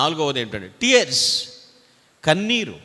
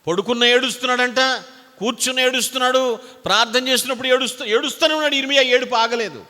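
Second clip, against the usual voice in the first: higher, rising preaching on weeping; impassioned preaching; 7 semitones higher than usual. Acted. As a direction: lift the voice, pressing the point. Preacher stressing Jeremiah's weeping. (ఉద్వేగంతో ఎత్తైన స్వరంలో బోధ)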